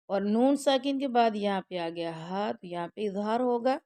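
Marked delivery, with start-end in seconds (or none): none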